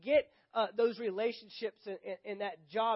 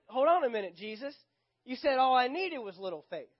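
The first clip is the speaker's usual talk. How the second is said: higher, louder, objecting appeal; indignant protest; 4 semitones higher, 6 dB louder than usual. Acted. Preacher objects to Jesus about little faith, indignant. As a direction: argue back, pitched up and loud.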